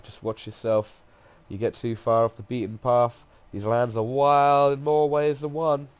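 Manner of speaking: lightly voiced drifter guide. Wanderer warning a traveller of wild lands, lightly voiced drifter. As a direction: lightly play an easygoing wanderer. Reads game guide's dialogue aloud.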